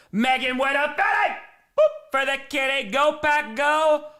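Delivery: shouting out the fans; excited shout-out. Real, not acted. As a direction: cheer them on. Hyped greetings to chatters.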